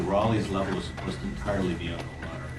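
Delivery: normal talking voice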